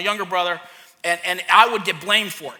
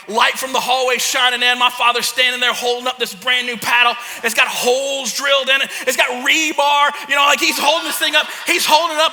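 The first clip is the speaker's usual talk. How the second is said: mounting mock-epic intensity; dramatic build; 3 semitones higher, 5 dB louder than usual. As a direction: build toward the big reveal. Building dramatic climax of story.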